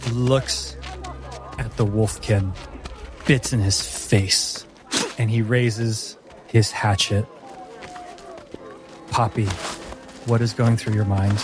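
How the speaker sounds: usual speaking voice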